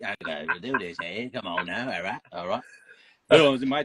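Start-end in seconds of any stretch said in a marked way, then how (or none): 0.0-2.6 lightly put-on British accent, pitched a bit lower; a hint of a cheeky Brit, only half committed. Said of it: "Don't offend all our, uh, UK fans".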